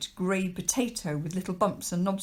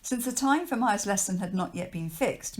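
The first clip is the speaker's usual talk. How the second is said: usual speaking voice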